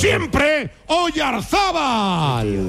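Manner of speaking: high, very slow, drawn-out name call; climactic commentator cry. Real, not acted. Commentator hailing Oyarzabal to listeners, long climactic cry. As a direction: stretch the player's name long, falling at the end.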